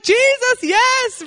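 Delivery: very high loud joyous cry; hammed-up joyful man; joyful yes. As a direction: shout your thanks. Man's joyous reply.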